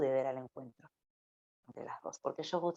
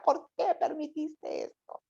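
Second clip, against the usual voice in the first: much higher, slow, tearful question to God; retold tearful plea; 11 semitones higher, slower than usual. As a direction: relive the crying plea, pitched high and slow. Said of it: "Lloraba y le decía". (súplica llorosa, muy aguda y lenta)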